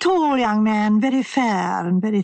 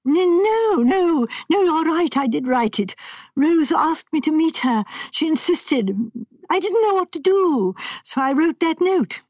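put-on higher, fretful voice of a nervous young man; distressed young man; 8 semitones higher than usual. A narrator's reading. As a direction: voice him anxious and upset. Sanford voiced in story.

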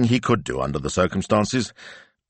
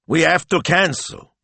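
high, louder exasperated boss; 12 semitones higher, 4 dB louder than usual. Acted. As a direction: throw up your hands and give up. Speranza throwing up his hands.

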